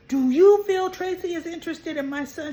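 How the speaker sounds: sudden high, loud alarmed question; a worried father suddenly on alert. Retold or imitated. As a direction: jump up in pitch and volume, alarmed. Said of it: "And Mac is like".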